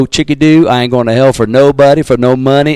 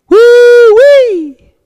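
high, loud preacher's whoop; 6 dB louder than usual. Real, not acted. Pastor reacting to judgment verse, loud high whoop. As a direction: let out a shout of amazement.

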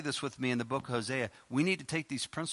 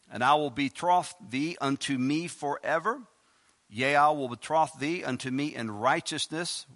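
lightly raised reading voice; scripture reading; 5 dB louder than usual. Acted. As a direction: read the verses out like a preacher. Reads scripture aloud.

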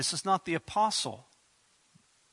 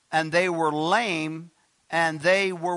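slow, loud verse reading; proclaimed scripture reading; 2 semitones higher, 6 dB louder, slower than usual. Acted. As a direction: draw it out, leaving the last word hanging. Reads verse, leaving a gap.

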